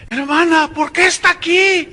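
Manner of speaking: put-on high, loud parody of the girl's question; mock dramatic questioning. Girl in preacher's parody asks damned woman, high theatrical cry. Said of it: "pregúntale tú a ella".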